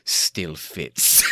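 lightly voiced movie character, low and pleased; imitated film line. Podcaster quoting a favorite film moment, lightly imitated low voice. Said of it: "I just love whenever he walks out".

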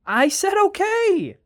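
very high, drawn-out retort; slow, high-pitched angry protest; exasperated shout. Acted. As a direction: shout back in frustration. Shouting back in a quarrel.

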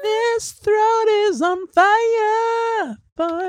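very slow high wail; dramatic mock wail. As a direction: wail it like an opera. Mock-echoing friend's burning throat.

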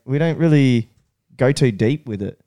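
ordinary talk

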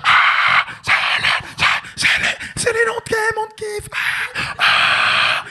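high, loud frenzied screams as an overexcited audience; hysterical crowd cheering. Acted. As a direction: mimic fans screaming themselves hoarse. Imitates crowd going wild.

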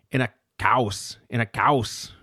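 imitated German accent, slowed and drawn out. Lecturer retells how Melanie Klein kept saying it, imitated German accent. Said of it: "she would say it with an accent".

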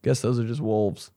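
normal talking voice

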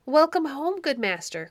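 high, loud call easing down on the end; cried greeting. A narrator's reading. Shepherd greeting his returning master, crying out. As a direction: call out a hearty welcome. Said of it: "cried the shepherd".

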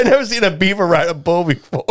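a hint of someone else's amused voice; quoted friend. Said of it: "He said".

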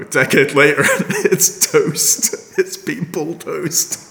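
amused disbelief, laughing it out. Real, not acted. Laughing at station's demolition.

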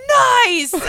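high, loud delighted whoop; retelling the brewery visit, a loud happy whoop; excited cheer. Retold or imitated. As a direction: cheer it out with a laugh. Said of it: "And I was like".

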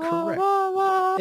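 high, loud excited exclamation. Real, not acted. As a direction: blurt it out with delight. Excited agreement.

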